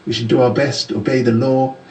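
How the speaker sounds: normal talking voice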